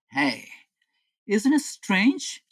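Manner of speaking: ordinary talk, nothing marked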